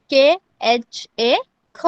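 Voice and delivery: letters spelled out slowly, one by one; slow spelling out. Shown as a demonstration. Teacher spelling a letter slowly for students. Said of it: "कैसे लिखना है?".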